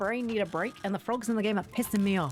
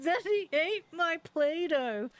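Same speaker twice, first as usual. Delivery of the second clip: put-on high, slow, drawn-out child's wail; upset child; 6 semitones higher, slower than usual. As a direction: wail it like a child beside himself, imitated. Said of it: "He's really upset".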